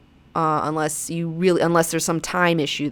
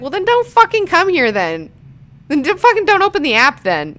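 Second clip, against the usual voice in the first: exasperated rant; 10 semitones higher, 5 dB louder than usual. Real, not acted. Ranting at Twitter complainers.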